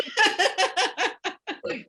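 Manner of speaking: bursting out in a high laugh; high cackling laugh. Real, not acted. Co-host keeps laughing.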